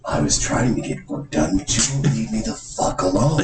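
irritated, drawling movie-villain impression; Jack Nicholson. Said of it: "Jack Nicholson starts going".

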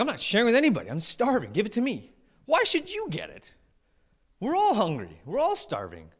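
hammed-up squabble, pitch jumping high; arguing. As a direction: protest indignantly, acting out a petty quarrel. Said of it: "and they're arguing".

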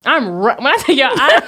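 ordinary talk, nothing marked

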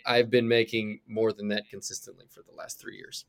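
ordinary talk

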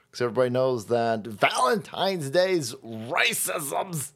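normal talking voice

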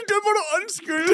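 high, squeaky, laughing voice; helpless giggly quote; laughing apology. Retold or imitated. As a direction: barely get the apology out through laughter. Repeating quote while laughing.

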